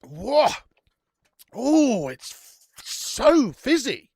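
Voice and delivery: startled whoa, then a slow, stretched so fizzy; sudden high surprise at the fizz. Real, not acted. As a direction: caught off guard by the bubbles. First sip overflowing with fizz.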